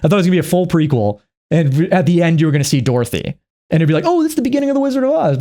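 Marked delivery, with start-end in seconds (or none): 4.0-5.4 much higher, fast, gushing realisation; mock delight. Audience realising it's The Wizard of Oz, a high, fast, gushing discovery. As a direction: squeal the discovery.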